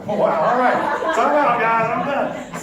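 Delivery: loud, laughing, slow mock surrender; hammed-up guy throwing in the towel with a laugh; overwhelmed guy. Student bullied by the professor, loud laughing surrender. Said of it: "The guy's like".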